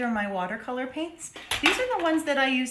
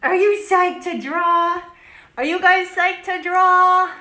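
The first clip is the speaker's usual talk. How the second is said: loud, high crowd call; excited, belted question; hype-up shout; 4 semitones higher, 10 dB louder than usual. Real, not acted. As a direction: holler it like a pep rally. Hyping up the viewers.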